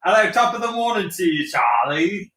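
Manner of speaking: cheerful put-on British accent; mock Irish-British accent. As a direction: greet him in a hammy British accent. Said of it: "British accents only".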